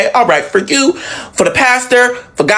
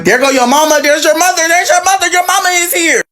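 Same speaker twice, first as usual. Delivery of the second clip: high excited aunt pointing it out; 8 semitones higher than usual. Retold or imitated. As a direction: call it out like the eager aunt. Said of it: "Aunt B B was like".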